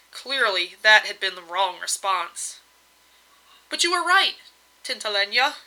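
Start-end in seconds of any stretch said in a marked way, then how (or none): 3.7-5.5 hasty. Acted. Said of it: "he added hastily".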